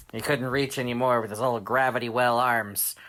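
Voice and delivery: ordinary delivery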